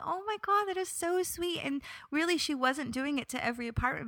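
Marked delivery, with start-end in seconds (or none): 0.0-1.6 much higher, lightly touched gush; mild delighted coo. Retold or imitated. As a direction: coo over the cookie gift. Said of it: "And I was like".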